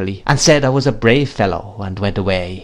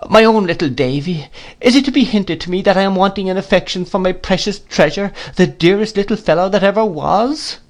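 much higher, pleading and fond; cling to the child in tears; tearful tenderness; 9 semitones higher than usual. A narrator's reading. Mother caresses Davy while pleading, much higher and tearful.

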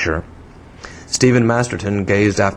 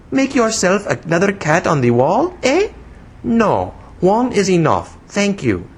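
put-on high, childish foreign-accented English; broken English in a little-girl pitch; broken English, infantine; 11 semitones higher than usual. A narrator's reading. Young woman scolding the man who startled her, childish foreign accent. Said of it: "broken English that seemed as much infantine as foreign".

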